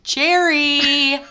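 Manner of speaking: calling out. Real, not acted. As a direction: call across the room. Calling out to a listener.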